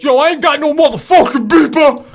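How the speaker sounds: loud, high, indignant yell; hammed-up shouted denial; imitated angry neighbor. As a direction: mimic a neighbor yelling back. Neighbor yelling back, retold.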